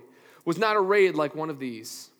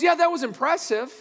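higher and louder, breezy shrug; God unimpressed; 8 semitones higher, 4 dB louder than usual. Retold or imitated. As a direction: brush off Solomon's glory with a light shrug. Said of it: "And he goes".

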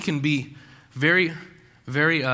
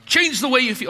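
mock command; 8 semitones higher, 4 dB louder than usual. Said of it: "you're like".

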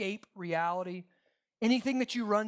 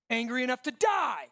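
louder, higher angry outburst; defiant shout; 6 semitones higher, 8 dB louder than usual. Acted. Jonah answers God back, defiant shout. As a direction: hurl the last word out as a shout.

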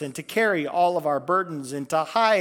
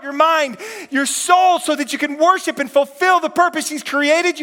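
fervent altar call; 9 semitones higher, 8 dB louder than usual. Acted. Pastor invites the church to follow Christ, preaching at full force.

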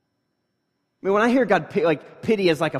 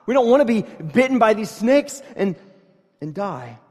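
mock pleading cry; 7 semitones higher, 8 dB louder than usual. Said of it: "they're crying out for mercy because they're like".

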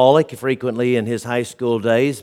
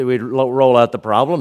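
ordinary talk, nothing marked

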